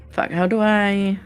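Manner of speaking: usual speaking voice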